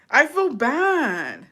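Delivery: slow, high drawn-out whine; near tears. Real, not acted. Reader feels bad for letter writer, near tears. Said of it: "I literally wanna cry".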